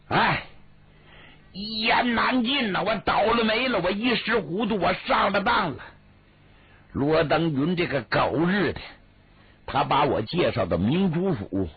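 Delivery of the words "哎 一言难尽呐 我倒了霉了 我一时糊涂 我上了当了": higher, pinched voice of a man who got duped; rueful prisoner voice. Acted. 魏六向赵壁诉苦，higher put-on voice, full of regret. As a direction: lament it like a small-time crook caught out. Wei Liu's lament.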